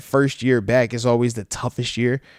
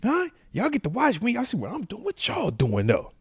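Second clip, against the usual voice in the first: curious; 9 semitones higher, 6 dB quieter than usual. Retold or imitated. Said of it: "I'm always like".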